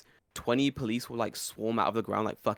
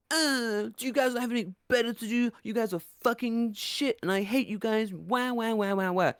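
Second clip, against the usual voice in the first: very high mocking whine; whiny crybaby; 12 semitones higher than usual. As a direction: wail it like a bratty toddler. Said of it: "don't act like a petulant child".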